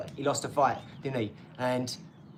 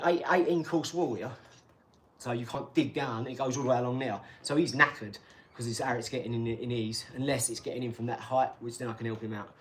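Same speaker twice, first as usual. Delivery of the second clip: ordinary talk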